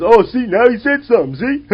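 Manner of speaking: high, loud hammed-up gloat; gloating bus guy. Heckler crows after comedian snaps back, loud high mock gloat. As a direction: crow like he finally got a rise out of him. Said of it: "And he goes".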